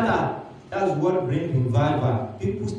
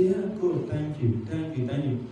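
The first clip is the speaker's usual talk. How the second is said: soft thanks; 3 semitones lower, 7 dB quieter than usual. Acted. Grateful pastor thanks God.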